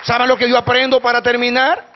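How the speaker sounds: loud, high rhetorical question to the crowd; booming pulpit question; preaching call. Acted. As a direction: throw the question out to the whole church. Preacher calls to congregation.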